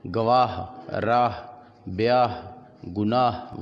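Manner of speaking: audible "h" closing गवाह, राह, ब्याह, गुनाह; sounded final h. Shown as a demonstration. Teacher showing haa-e malfoozi, राह and गुनाह ending on clear h. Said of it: "जो खुलकर पढ़ी जाए".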